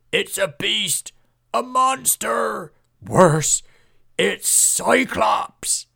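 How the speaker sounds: high, loud, drawn-out cries of terror; terrified shout. A narrator's reading. Panicked villager screams the monster's name to all.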